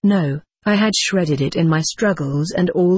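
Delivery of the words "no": ordinary delivery, nothing marked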